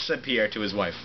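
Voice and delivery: ordinary talk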